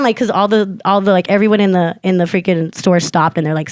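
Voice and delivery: ordinary talk, nothing marked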